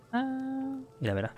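usual speaking voice

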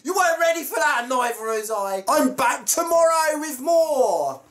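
high, shrieky excited sign-off; laugh, then cry out high in excitement; excited shout. Real, not acted. Vlogger startled by the balloon pop, excited high shouting.

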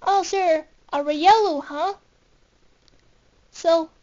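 ordinary delivery, nothing marked

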